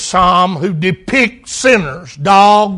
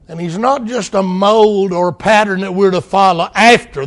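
normal talking voice

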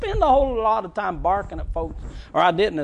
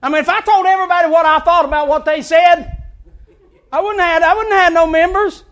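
loud laughing rant; 8 semitones higher, 7 dB louder than usual. Pretend. Preacher mock-telling off congregation.

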